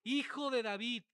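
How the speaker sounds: loud, high imitated cry; high-pitched, forceful yell; retold shout. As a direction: belt it out as the beggar's call. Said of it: "cuando grita".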